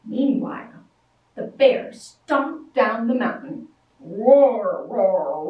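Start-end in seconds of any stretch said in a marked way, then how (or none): none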